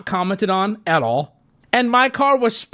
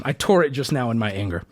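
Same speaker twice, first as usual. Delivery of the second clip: ordinary talk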